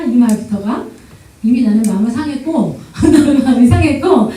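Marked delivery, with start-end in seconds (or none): none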